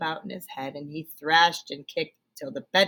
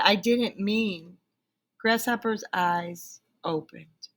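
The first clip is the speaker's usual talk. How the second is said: usual speaking voice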